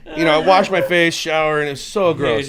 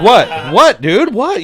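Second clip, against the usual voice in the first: louder, higher alarmed questions; starting to freak out; 6 semitones higher, 7 dB louder, slower than usual. Retold or imitated. Hungover guy to his gagging friend, retold mild panic. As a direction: re-enact rising alarm at a friend's reaction. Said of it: "I'm starting to freak out".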